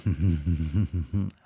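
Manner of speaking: low, sly chuckle. Real, not acted. Host laughing at own cheeky question, low chuckle.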